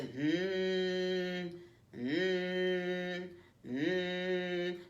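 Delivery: hummed mm mm mm like a vibrating phone; buzzing phone. Retold or imitated. Coach mimics phone vibrating during interview, hummed buzz.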